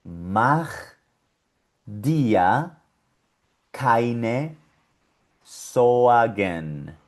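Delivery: Mach, dir, keine, Sorgen read word by word; German phrase word by word. Shown as a demonstration. Teacher reading the German phrase word by word. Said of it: "Vamos a leerla completa".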